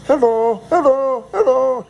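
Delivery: high, mocking sing-song hellos. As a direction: tease them with a singsong hello. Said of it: "they just mocked us in, in broken English".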